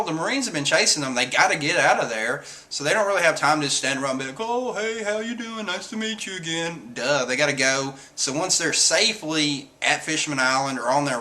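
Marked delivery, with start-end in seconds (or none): 4.4-6.9 soft, high put-on pleasantries; mock small talk. Straw Hats standing around chatting, mockingly soft and high. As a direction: imitate idle chit-chat in a small, high voice. Mocking an idle reunion chat.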